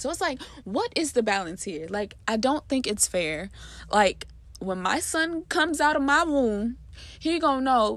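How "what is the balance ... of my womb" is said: ordinary delivery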